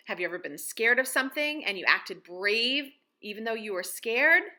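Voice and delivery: usual speaking voice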